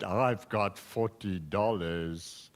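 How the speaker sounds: lightly voiced proud rich girl, slow. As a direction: Jane boasting to the class, low and slow. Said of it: "she walked very proudly".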